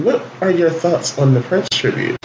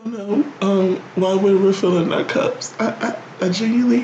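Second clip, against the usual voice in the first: mock crying; 7 semitones higher than usual. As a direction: pretend to cry through the words. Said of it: "clearly he's crying".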